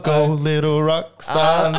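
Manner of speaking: high, slow put-on cheering voice; mock TikTok cheer; TikTok quote. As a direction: cheer on like the viral clip. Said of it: "that TikTok".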